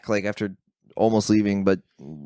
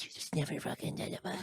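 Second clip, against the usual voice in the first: much softer, breathy couple squabble; hushed mock argument; whispered bickering; 5 semitones higher, 16 dB quieter, faster than usual. Married couple fighting in the front row, whispered. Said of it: "They're like".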